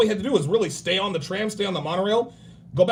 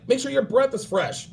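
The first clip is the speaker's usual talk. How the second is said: ordinary delivery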